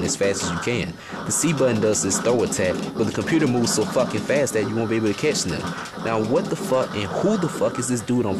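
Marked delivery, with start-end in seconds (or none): none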